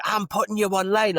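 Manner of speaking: lightly hammed smug threat; mock threatening woman. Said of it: "And she was like".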